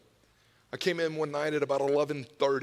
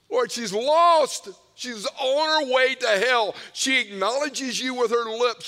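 loud, high, impassioned cry to God; pleading prayer; 8 semitones higher, 7 dB louder than usual. Retold or imitated. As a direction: re-enact a parent's desperate intercession at full voice. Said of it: "we prayed for you".

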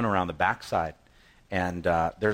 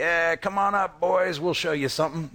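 higher, casual mocking call; lazy taunt; 6 semitones higher than usual. Preacher voicing Philistines lazily taunting Jonathan, higher mock call. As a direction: wave them up like it's nothing. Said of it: "And that's what they say".

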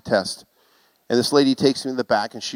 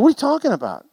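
baffled question; 12 semitones higher, faster than usual. Retold or imitated. Husband baffled at wife.

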